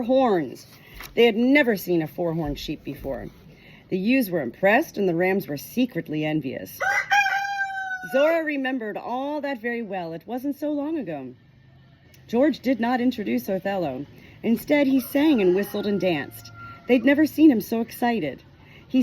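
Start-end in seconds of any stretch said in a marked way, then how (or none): none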